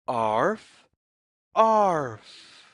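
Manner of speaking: plain reading voice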